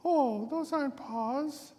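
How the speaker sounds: squeaky high falsetto denial; wolf pitching way up to pass as grandma; hammed-up high old-lady voice; fake grandma voice. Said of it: "what big paws you have".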